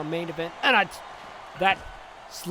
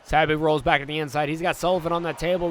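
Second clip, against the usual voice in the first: usual speaking voice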